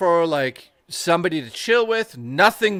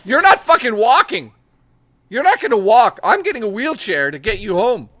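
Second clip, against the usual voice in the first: mock boast; 4 semitones higher, 6 dB louder than usual. Boastful mock threat to date.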